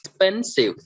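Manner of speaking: "expensive" with PEN jumping high; stress on middle syllable. Shown as a demonstration. Teacher giving a middle column example, middle stress on "expensive". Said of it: "So we stress on spend".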